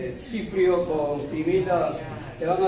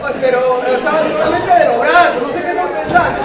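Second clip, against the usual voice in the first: very loud, high, indignant telling-off; exasperated protest; 8 semitones higher, 14 dB louder than usual. Real, not acted. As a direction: shout down the giggling audience. Scolds laughing listeners loudly.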